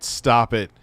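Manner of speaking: ordinary talk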